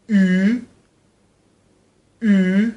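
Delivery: "او" long and loud with pursed lips, between ee and oo; drawn-out rounded vowel. Shown as a demonstration. Teacher drills the alphabet for repetition, "او" long and lip-rounded. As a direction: hold "او" firmly through rounded lips. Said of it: "به صورت کشیده با قنچه کردن لب".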